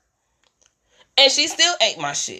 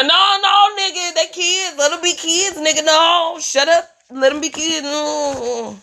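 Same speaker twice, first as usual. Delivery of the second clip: higher teasing retort; mocking high voice; 5 semitones higher than usual. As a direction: throw it back, mocking. Mocks back in high voice.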